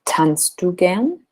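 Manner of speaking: "Tanzt du gern?" slow, with "gern" rising at the end; slow yes-no question, "gern" rising; rising question tune. Shown as a demonstration. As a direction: let the voice go up on "gern". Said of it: "my voice goes up a little bit in the end".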